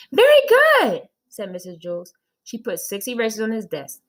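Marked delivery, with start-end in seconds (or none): 0.1-1.1 high, loud burst of praise; delighted praise. Acted. Teacher praises Joe's counting, high and loud. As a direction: cheer the child on.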